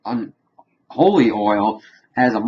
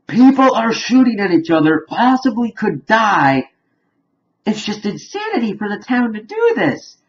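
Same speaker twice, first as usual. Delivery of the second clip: high-pitched, exasperated disbelief; incredulous outburst; 11 semitones higher, 4 dB louder than usual. Real, not acted. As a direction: exclaim at the sheer insanity of it. Reacting to townsfolk picnicking during gunfight.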